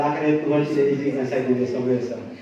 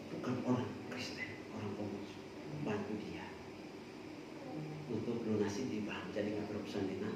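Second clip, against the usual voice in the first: hushed report; 6 semitones lower, 17 dB quieter than usual. Retold or imitated. Quoted woman, very soft.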